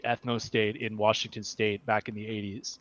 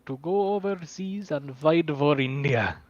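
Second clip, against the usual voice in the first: high-pitched mock Indian accent; 6 semitones higher than usual. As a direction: plead politely in a hammed-up Indian accent. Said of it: "We need more Indian accents".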